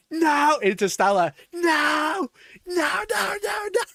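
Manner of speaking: high, loud, frantic no; shouting no. Real, not acted. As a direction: yell it in mock horror. Mock shouting at the player.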